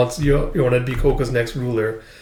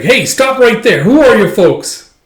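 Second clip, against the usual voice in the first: loud, high challenge; challenging call; 9 semitones higher, 8 dB louder than usual. Retold or imitated. Villager stopping unknown strangers, challenging. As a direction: call out sharply to stop strangers.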